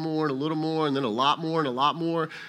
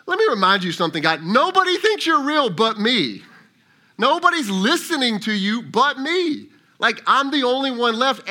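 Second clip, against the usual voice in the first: much higher and louder, indignant; exasperated Elijah; 5 semitones higher, 5 dB louder than usual. Retold or imitated. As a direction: protest at God in disbelief, fast in spots. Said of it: "perhaps it was something like this".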